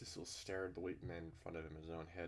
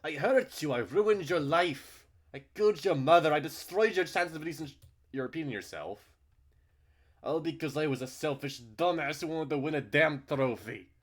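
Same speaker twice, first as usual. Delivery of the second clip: loud, high self-blaming shout; a furious, guilt-ridden outburst; 10 semitones higher, 18 dB louder than usual. Acted. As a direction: scream the confession at him.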